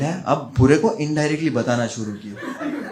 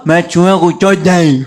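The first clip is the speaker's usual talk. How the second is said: slow, distorted mumbling voice; loud slowed dazed mumble; Aba Kadar's real way of saying it; 2 semitones higher, 7 dB louder than usual. Retold or imitated. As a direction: slur it slowly like a dazed old man. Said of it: "वो ऐसे नहीं बोला".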